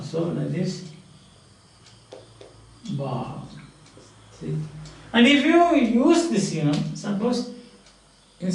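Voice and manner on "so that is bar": "Car" ending on an open long vowel; silent final R. Shown as a demonstration. Teacher showing R is not realized at word end, silent R. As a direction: leave the R off "Car". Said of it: "never pronounced at the end of".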